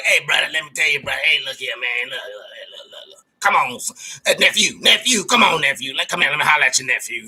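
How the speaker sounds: lightly voiced street hustler, leaning in with a pitch; crackhead Joe Morgan. Retelling how the friend came at him, mild crackhead hustler voice. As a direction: sell it like a fidgety hustler working his nephew. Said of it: "you came to me like a crackhead".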